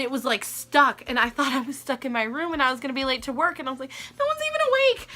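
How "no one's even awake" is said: pitched far up, mock-whining; whiny complaint. Stuck in her room and late for work, a high whiny moan.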